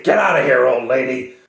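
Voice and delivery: loud rough bark; gruff guard order. Acted. Guards chasing the old woman away, loud. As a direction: drive her off with a shout.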